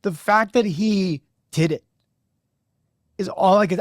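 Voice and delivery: fighting tears as he tells it; holding back tears. Real, not acted. Host tearing up on the podcast over his player, choked voice. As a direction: voice up high, choked with emotion. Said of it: "I'm crying on the podcast".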